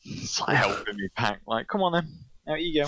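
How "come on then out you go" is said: cheery mum. Retold or imitated. Mum cheerfully helping child leave.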